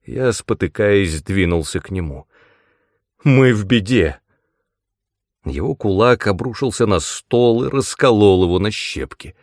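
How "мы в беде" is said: loud, distressed cry; alarmed announcement. A narrator's reading. Hero stumbles toward Aahz with bad news, alarmed cry. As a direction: blurt it out in alarm.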